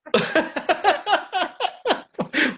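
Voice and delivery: bursting into high laughter; real delighted laugh. Real, not acted. Host laughs at guest's jab.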